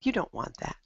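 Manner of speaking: ordinary talk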